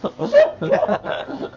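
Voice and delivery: high breathy unhinged cackle; airy mad laugh; high wheezing cackle. Acted. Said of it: "and laughs kinda like this".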